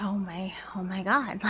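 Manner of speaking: near-whispered imitation of horror; soft, mock-appalled aside; hushed shock. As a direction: drop to a whisper, as if overhearing something awful. Retold horrified eavesdropper reacting.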